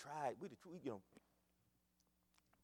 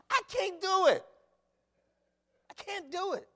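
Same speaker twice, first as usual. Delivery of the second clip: high, loud cry of defeat; mock despair; 14 semitones higher, 10 dB louder than usual. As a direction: throw up hands, shouting you can't. Preacher voices overwhelmed believer.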